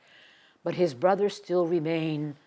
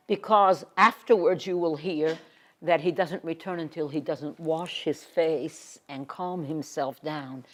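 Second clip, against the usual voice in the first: ordinary talk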